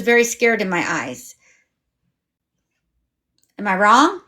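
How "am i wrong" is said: ordinary delivery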